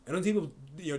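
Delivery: ordinary talk, nothing marked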